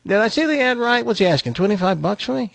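high, chatty talking-dog voice. Acted. Dog asks buyer about his price, talking-dog voice. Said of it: "He says".

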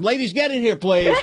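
high, playful call to the ladies. Real, not acted. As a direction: summon them in with a sing-song call. Calling co-hosts into the studio.

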